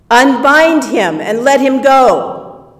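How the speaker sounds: loud, firm yelled order; "Unbind" called loudly to the men; yelled command. Acted. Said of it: "yelled to the guys".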